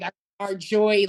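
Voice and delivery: ordinary delivery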